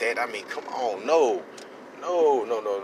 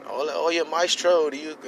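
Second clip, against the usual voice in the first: imitating Spanish-speaking guy; 6 semitones higher than usual. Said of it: "I only speak Spanish".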